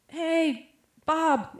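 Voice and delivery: high-pitched retold hail; calling out. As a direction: call a buddy over across the lot. Man calls to an acquaintance.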